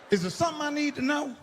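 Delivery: suspicious question. Retold or imitated. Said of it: "I told her".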